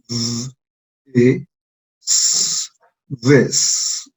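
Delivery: z, e, s sounded one at a time, then this; sounding out this. Shown as a demonstration. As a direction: build this from its sounds, slowly. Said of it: "sumamos los tres sonidos".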